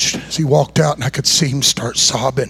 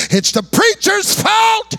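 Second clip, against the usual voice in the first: high, drawn-out accusing yell; shouted blame; slower than usual. Retold or imitated. Drinking father blaming the preacher to his kids, shouting. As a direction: holler it slowly, laying the blame.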